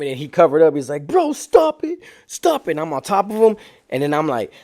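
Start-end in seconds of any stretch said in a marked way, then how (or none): 1.1-2.7 very high squealed protest; mock panicked plea. Beaten man covers up and pleads, mock panicked plea. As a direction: imitate a victim begging, voice way up.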